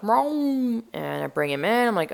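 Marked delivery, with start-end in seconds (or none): 0.0-0.8 high, drawn-out imitated meow; cat meow. Owner imitates her rescued cat, high meow. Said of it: "He's all like".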